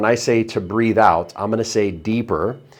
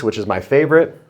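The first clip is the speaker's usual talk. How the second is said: ordinary talk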